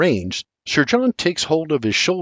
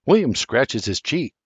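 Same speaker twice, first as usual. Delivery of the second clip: ordinary delivery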